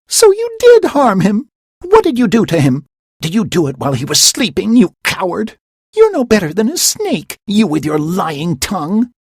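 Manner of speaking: high, loud, fast fury; furious accusation. Acted. Hermia accusing Demetrius of harming Lysander, furious. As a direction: tear into him.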